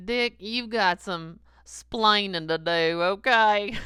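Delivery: booming scold from God; loud mock God. God scolds Dick for his sins, booming loud. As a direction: call him out like a stern boss. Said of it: "he was like".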